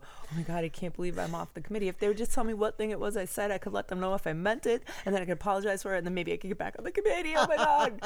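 hammed-up anxious rambling; mock panic spiral. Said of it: "and go".

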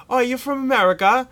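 hammed-up cockney teenager; cockney teen. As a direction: ape a cheeky London teenager. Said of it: "cockney little British kids".

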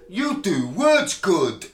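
loud, slow, high mock praise; mocking condescension. Festival acquaintance's subtext to a working class trader, mock patronising. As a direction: hammer it out slowly, as if praising a simpleton. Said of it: "The subtext was".

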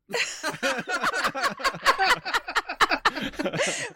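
bursting into high laughter. Real, not acted. Guest laughs at broken line.

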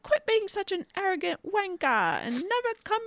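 high mock British scolding from M; shrill-free but high, scolding Bond as M; mock posh M. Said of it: "she's like".

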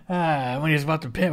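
ordinary delivery

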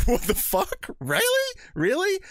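high incredulous what the fuck, really really; disbelief. Retold or imitated. Ben reacting to the drunk guy's spoiler, high and stunned. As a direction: squeak it out in shock, pitch shooting up. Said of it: "Ben's like".

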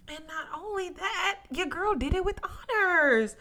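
triumphant hype; proud brag. Real, not acted. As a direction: show off, bursting with pride. Bragging about graduating.

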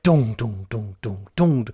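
low chanted drum hits, dong-dong; imitated drum beat.

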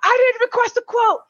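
high, agitated impression of an irate caller; imitated freak-out. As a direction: fire it back like a man losing it. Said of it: "And he starts freaking out".

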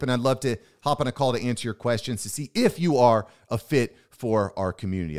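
ordinary talk

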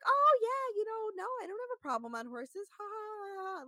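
high-pitched mock giggly quote; put-on laugh tacked onto a breezy quote; imitated laughing it off. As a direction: play her laughing along, airy and high. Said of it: "they're, like, laughing about it".

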